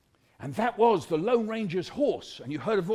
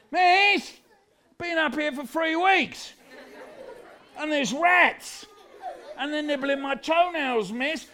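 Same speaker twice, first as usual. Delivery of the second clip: put-on high child's wail for help; complaining kid, high and drawn out; pleading pupil; 6 semitones higher, slower than usual. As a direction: call up plaintively to the teacher. Imprisoned pupil calls out to teacher.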